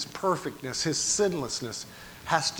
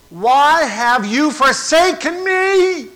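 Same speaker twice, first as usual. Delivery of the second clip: high, slow, drawn-out shout; loud cry; 12 semitones higher, 11 dB louder, much slower than usual. Acted. Preacher voices Jesus forsaken on the cross, loud drawn-out cry. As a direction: wail the question at full voice. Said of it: "Jesus cried with a loud voice".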